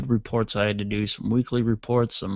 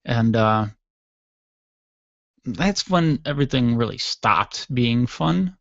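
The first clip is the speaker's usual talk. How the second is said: normal talking voice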